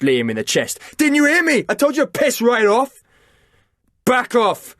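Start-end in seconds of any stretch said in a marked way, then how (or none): 1.0-3.0 much higher, loud aggressive yell; furious shout. A narrator's reading. Chris shoving Liam and telling him off, furious shout. 4.0-4.7 much higher, louder barked command; sharp order. A narrator's reading. Liam ordering Chris to back off, barked command. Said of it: "ordered Liam".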